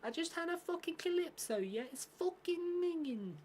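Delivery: very high, squeaky Scouse falsetto; Scouse woman. Acted. Mocking a Scouse woman moaning about a calypso, high Scouse accent. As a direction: screech it as a mock Scouse woman. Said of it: "like, a Scouse woman".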